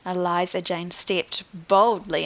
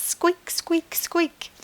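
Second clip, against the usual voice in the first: very high tiny squeaks; squeaking dino; 9 semitones higher, much slower than usual. Acted. Storekeeper imitating little fluffy dinosaurs, very high squeaks.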